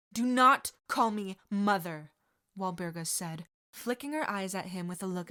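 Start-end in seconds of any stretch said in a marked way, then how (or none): 0.1-2.1 louder, clipped reprimand with NOT stressed; icy correction; cold rebuke. Acted. Walburga correcting Sirius, louder cold rebuke. Mother coldly rebukes son.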